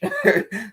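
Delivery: short amused chuckle; quick high-pitched laugh. Real, not acted. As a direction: chuckle at your own remark. Laughs at own rubbish remark.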